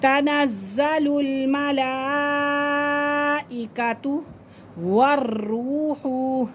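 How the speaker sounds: slow chanted tajweed recitation, long madd; Quran recitation. Shown as a demonstration. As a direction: draw the vowels out long like a qari. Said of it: "ये पाँच से छः अलिफ की मिकदार खींच कर".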